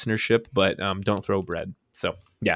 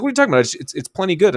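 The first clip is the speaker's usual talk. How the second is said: much higher mocking manager voice; dismissive manager; 9 semitones higher, much faster than usual. Manager dismissing the customer, mocking high voice. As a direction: brush off the complaint, high and scoffing. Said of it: "he's like".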